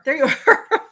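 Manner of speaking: laughing. Real, not acted. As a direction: break into a laugh mid-phrase. Finds viewer, repeats it laughing.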